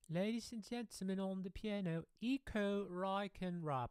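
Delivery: grand high-pitched English announcer; UK stage announcer. Retold or imitated. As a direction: announce the pianist with a showy British lilt. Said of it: "played in the U K".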